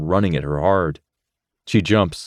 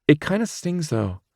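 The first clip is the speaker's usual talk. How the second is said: Rachel shrugs off a small sting; Rachel's voice; 9 semitones higher than usual. Acted.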